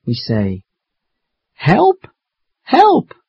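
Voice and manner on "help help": high, frightened calls; scared cry for help. Acted. Two children up a tree, scared calls for help. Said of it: "Now we feel scared".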